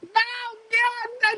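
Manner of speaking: comic falsetto sob to God; mock crying plea. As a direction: whimper at God like a melodramatic crier. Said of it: "I'm always very upset when I cry".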